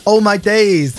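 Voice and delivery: mock London youth in MLE; Oh my days in a London MLE accent. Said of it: "speaking M L E".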